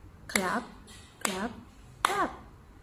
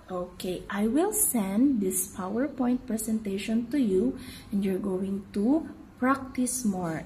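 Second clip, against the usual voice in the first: usual speaking voice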